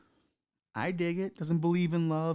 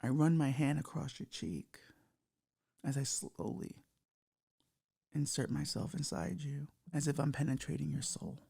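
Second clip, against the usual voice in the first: sultry cyber demo; 4 dB quieter than usual. Shown as a demonstration. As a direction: breathe the last words as an intimate whisper. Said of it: "That's how you do it".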